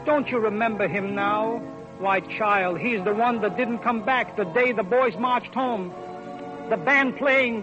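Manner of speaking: ordinary talk, nothing marked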